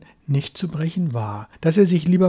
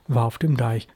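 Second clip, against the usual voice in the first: usual speaking voice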